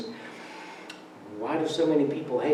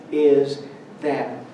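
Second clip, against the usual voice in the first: ordinary talk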